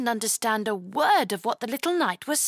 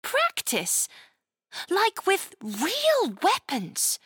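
put-on much higher, eager girl voice; brightened up; 9 semitones higher, slower than usual. Chloe excited by knight training, eager and high. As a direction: perk up with sudden excitement. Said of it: "Chloe brightened up".

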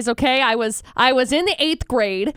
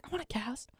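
hushed, voiceless whisper; whispered wish; 19 dB quieter than usual. Retold or imitated. Five-year-old envying a classmate's cast, whispered wish. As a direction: whisper it like a secret little longing.

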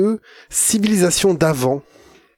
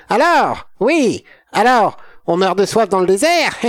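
mad scientist voice; 3 semitones higher than usual. Acted. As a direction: play a loopy mad scientist. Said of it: "je te fais une imitation de savant fou".

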